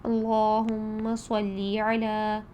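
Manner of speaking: slow drawn-out Arabic recitation of اللہم صلی علی, each vowel clear. Shown as a demonstration. Teacher recites to students, slow careful Arabic. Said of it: "درود ابراہیمی میں دیکھیے".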